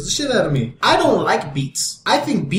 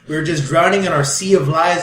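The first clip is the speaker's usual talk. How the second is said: normal talking voice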